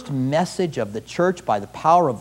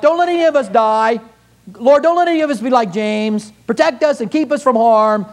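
higher, louder, fast pleading; timid self-serving prayer; 7 semitones higher, 4 dB louder than usual. Retold or imitated. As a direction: beg God to keep you safe. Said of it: "this is how I would have prayed".

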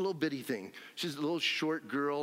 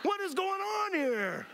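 bewildered laughing shout; 14 semitones higher, slower than usual. Retold or imitated. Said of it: "he's like".

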